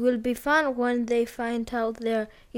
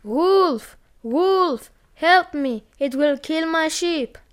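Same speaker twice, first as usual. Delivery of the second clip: higher, louder staged alarm call; fake cry for help; 5 semitones higher, 3 dB louder, slower than usual. A narrator's reading. Bored boy tricking the villagers again with a fake shout. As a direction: yell for help, hamming up the danger. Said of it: "the fake cry for help".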